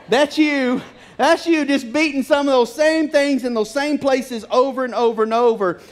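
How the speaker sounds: amused, pointed preaching; laughing rebuke. Acted. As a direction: drive the rebuke home with a chuckle. Preacher repeats God's rebuke.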